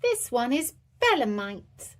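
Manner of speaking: a hint of a keen little expert; little elephant showing off his knowledge; clever boy. Edmund voiced in story.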